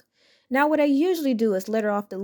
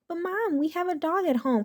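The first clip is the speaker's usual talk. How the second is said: put-on a small kid meekly pointing it out; small boy; 7 semitones higher than usual. Karen's son protests to her.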